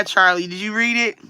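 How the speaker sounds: ordinary delivery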